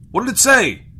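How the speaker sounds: very loud, high-pitched shout; captain exploding with impatience; shouted demand. A narrator's reading. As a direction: yell for an answer. Captain repeats more forcefully.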